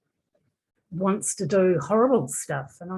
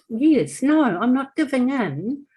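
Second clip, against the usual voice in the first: high, loud and defiant; upset insistence; 6 semitones higher, 5 dB louder than usual. Real, not acted. Client insisting to the coach she's fighting it, loud and defiant. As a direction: refuse to give in, voice lifted.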